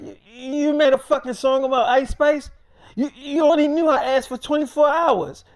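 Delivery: high, loud incredulous retelling of anger; mad outburst. Retold or imitated. Friend confronting David over the song, mad outburst. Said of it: "still mad about the whole thing".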